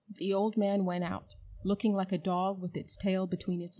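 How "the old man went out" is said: ordinary delivery, nothing marked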